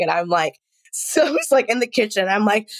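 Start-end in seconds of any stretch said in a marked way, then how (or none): none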